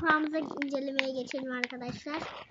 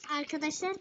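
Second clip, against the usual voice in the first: usual speaking voice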